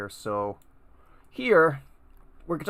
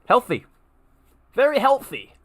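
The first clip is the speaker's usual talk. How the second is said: mock approval; 11 semitones higher, 9 dB louder than usual. Ironic comment on sugary cereal.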